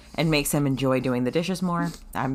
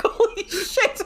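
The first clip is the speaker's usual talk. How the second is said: mock husband surprise; slower than usual. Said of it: "He was like".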